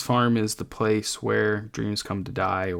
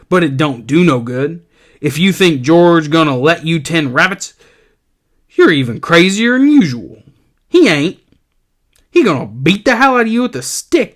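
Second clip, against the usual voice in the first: loud, higher, jeering rabbit voice; mean giant rabbit; 4 semitones higher, 7 dB louder than usual. Acted. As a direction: taunt him cruelly. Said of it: "the big rabbit comes up, and he says".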